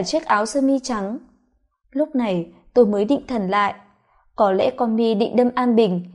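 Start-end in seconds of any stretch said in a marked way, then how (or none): none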